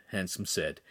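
usual speaking voice

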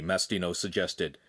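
plain reading voice

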